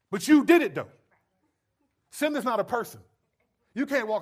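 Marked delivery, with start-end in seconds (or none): 0.1-0.9 loud, high comeback, hammed up; insistent accusation. Accuser answering the sinner's excuse, insistent accusation. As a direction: push the blame right back, much louder and higher.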